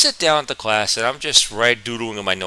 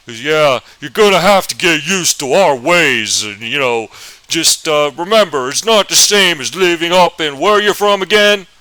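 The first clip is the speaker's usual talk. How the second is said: Southern teacher voice; 4 semitones higher, 6 dB louder than usual. Retold or imitated. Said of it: "That's actually how he sounded".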